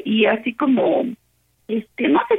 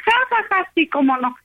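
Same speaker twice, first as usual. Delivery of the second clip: imitated scoffing laugh, pitched up then dropping; mock laughing; 7 semitones higher than usual. Retelling the other woman's reply to her friend, mock laughing. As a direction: act out a high, derisive ha-ha-ha. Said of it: "se empieza a reír".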